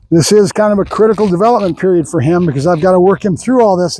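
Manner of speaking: ordinary talk, nothing marked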